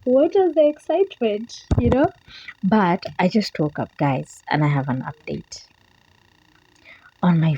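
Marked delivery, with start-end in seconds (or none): 0.0-2.1 puzzled wondering. Retold or imitated. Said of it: "and wonder".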